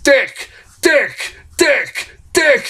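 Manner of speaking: high, emphatic retold correction; insistent repeating. Dastardly correcting Scooby about his name, insistent. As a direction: hammer each repeat as its own correction. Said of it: "He keeps saying".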